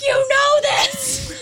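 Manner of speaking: high, slow shouted prompt. Retold or imitated. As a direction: yell it like a frazzled teacher. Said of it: "she's like".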